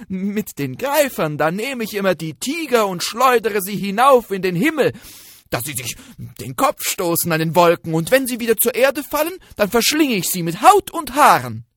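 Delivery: boastful shout. Acted. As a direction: boast to scare the tiger off. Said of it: "und dann rief es". (lautes, prahlerisches Hirschlein)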